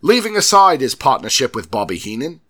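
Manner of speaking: loud fake British accent losing its footing; drifting fake accent. Host reading on as the accent changes, drifting fake British accent. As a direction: let the put-on British accent wander. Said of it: "it completely changed".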